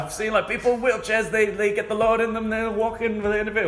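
high hammed-up preacher voice; hammed-up preacher testimony; mock preacher. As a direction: preach it high and fervent, imitating. Imitating preacher on stage.

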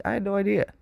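high, embarrassed disbelief; sheepish excitement. Retold or imitated. Retelling own realization.

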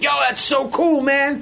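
high, gushing fan voice; super fan. Retold or imitated. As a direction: imitate an admiring super fan. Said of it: "like a super fan".

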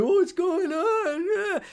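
high, drawn-out whiny mock voice; mock the clingy boyfriend, stretched and high; needy beta guy. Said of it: "None of that stupid, dopey, pussy, beta boy shit".

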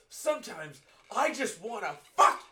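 high, loud cry building to the last word; mock screamed outburst. Imitating the tied-up man's screaming, mock outburst. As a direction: yell it like an abruptly cut-off rant. Acting out film's final outburst.

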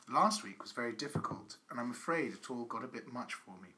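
low, measured reading aloud; letter reading. Acted. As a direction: read a solemn confessional letter, deadpan. Reads out a letter.

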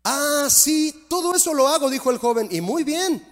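put-on a cocky rich young man praising himself; smug young man. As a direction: preen like he's already perfect. Smug young man voiced, high.

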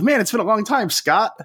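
imitating the recruit's overconfident hello; confident mistaken greeting. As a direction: greet him like an old buddy, dead certain of the wrong name. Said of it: "the way he said it was so confidently".

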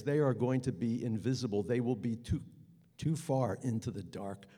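usual speaking voice

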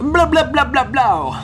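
high, loud nonsense babble; mock babble.

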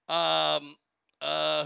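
ordinary delivery

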